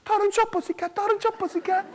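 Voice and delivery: high-pitched sing-song mama calling; high mother voice. Acted. Mammy calling her son in a high voice.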